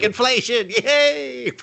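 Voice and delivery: very high, hammed-up cheer; mock cheering. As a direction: cheer like clueless townsfolk. Townsfolk naively cheering inflation.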